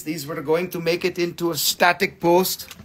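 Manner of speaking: ordinary talk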